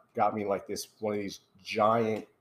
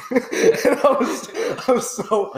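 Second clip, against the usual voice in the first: words squeezed out through real laughter; laughing so hard the voice cracks up high; breathless giggling while admitting embarrassment; 13 semitones higher, 7 dB louder, slower than usual. Real, not acted.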